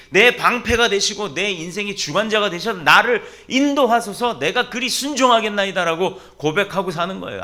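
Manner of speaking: loud, high, driving exhortation; impassioned sermon climax with ringing prayers; forceful preaching. Real, not acted. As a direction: urge the congregation on at full voice. Preacher exhorts congregation at full force.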